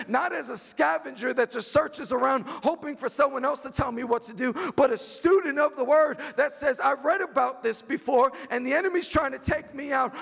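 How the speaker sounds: fervent preacher's cry; preaching. Acted. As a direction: proclaim it over the church. Preaching at full force.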